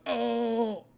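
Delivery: high-pitched drawn-out oh; retold startled cry. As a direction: let out a surprised high oh. Acts out startled bump.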